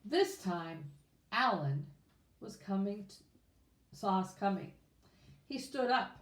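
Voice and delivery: plain reading voice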